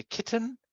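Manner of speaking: "kitten" with a clearly articulated plain T in the middle; non-aspirated T. Shown as a demonstration. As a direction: say "kitten" with the tongue releasing a crisp, unaspirated T. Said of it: "you can have the non-aspirated one".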